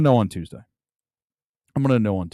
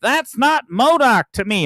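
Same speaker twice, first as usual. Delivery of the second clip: high, slow mocking fan voice; high mocking impression of a comic fan; mock nerd voice; 10 semitones higher, 4 dB louder, slower than usual. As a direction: sneer it in a silly fanboy voice. Mocking a fan's voice.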